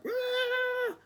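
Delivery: very high falsetto offended ah; a squeaky affronted gasp; mock gasp. Imitating the friend reacting to boundary, squeaky high gasp. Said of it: "the person was like".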